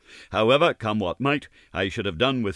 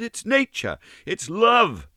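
Ned's plea pitched higher and louder; pleading; 5 dB louder, slower than usual. Acted. Ned pleading with Gladys, ardent higher voice. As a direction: plead it, rising with feeling.

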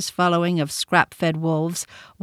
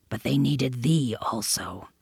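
low, softer panther voice; hushed panther; 6 semitones lower, 6 dB quieter than usual. A narrator's reading. Panther warning Mowgli quietly, hushed. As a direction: keep it low and secret.

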